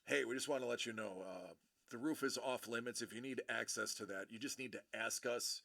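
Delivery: soft, low, apologetic official; much nicer voice. Retold or imitated. Guard hands back the camera and explains the roof rule, nicer voice. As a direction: imitate staff politely smoothing things over, hushed. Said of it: "in a much nicer voice".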